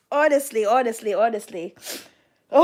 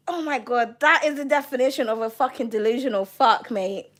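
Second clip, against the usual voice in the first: normal talking voice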